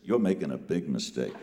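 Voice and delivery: low, unruffled caution; calm, knowing threat; cool warning. Retold or imitated. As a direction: answer a screamer with deep calm. Herbie coolly answers screaming principal.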